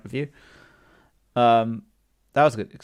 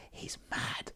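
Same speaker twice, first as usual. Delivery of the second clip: whispering; 19 dB quieter than usual. Real, not acted. Gossiping about Vista's unhinged play.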